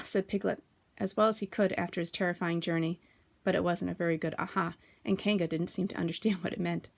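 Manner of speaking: ordinary delivery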